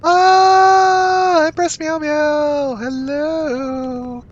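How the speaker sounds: very high, slow cooing cry; joyful greeting. Real, not acted. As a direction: coo lovingly at the cat. Greets her pet with joy.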